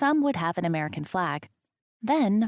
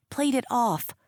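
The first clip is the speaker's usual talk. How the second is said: plain reading voice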